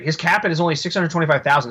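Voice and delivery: ordinary talk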